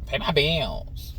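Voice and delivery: mock demanding woman. Just saying.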